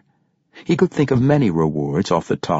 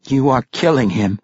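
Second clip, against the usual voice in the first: grim accusation, pitched up; 8 semitones higher, 4 dB louder than usual. A narrator's reading. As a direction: accuse him grimly. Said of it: "Regis said grimly".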